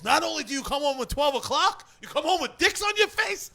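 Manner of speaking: loud, high-pitched mock outrage; scolding dad. Storyteller voicing a dad scolding his kid, mock furious.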